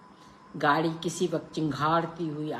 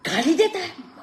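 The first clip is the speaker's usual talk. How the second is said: much higher, loud indignant cry; outraged shout; 8 dB louder than usual. A narrator's reading. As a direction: shriek it in outrage. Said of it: "बाबू चिल्लाया".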